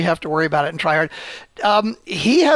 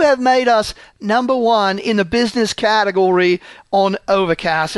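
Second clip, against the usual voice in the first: put-on Cockney accent; 3 semitones higher than usual. Host thanking fans for recommendations, in a mock Cockney accent. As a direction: try a wobbly, exaggerated Cockney. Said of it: "started to slip from your Cockney accent".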